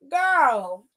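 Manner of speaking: ordinary delivery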